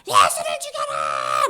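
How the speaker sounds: loud, slow strained yell; mob shout. Acted. Paid crowd demanding Jesus' death, strained shout. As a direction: roar it out slowly.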